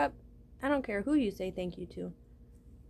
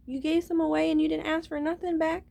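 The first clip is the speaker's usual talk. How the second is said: incredulous; 7 semitones higher, 5 dB louder than usual. Acted. God questioning the listener's generosity.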